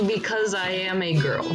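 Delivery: ordinary talk, nothing marked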